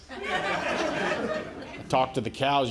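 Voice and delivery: ordinary delivery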